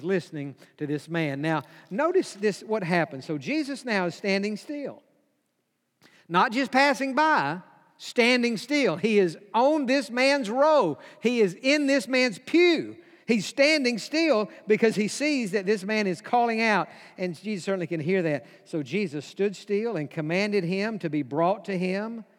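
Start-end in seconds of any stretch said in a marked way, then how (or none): 6.3-16.9 raised, high preaching voice; slow, ringing sermon climax; emphatic preaching. Real, not acted. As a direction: drive each phrase home from the pulpit.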